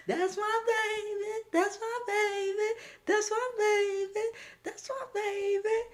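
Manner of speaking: high, tearful, slow; high crying voice. Retold or imitated. Mom crying over her son.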